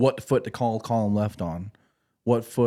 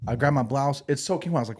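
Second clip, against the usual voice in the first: ordinary delivery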